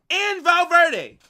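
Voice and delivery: loud, high, slow indignant cry; outraged exclamation. Real, not acted. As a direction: stretch it out in outrage at the offer. Outraged at transfer offer.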